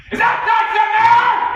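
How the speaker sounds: huge, slow, bellowing boss shout; booming voice of doom. Acted. The captain catching him sneaking out, a booming slow bellow. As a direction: boom it out like doom arriving. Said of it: "the booming voice of doom".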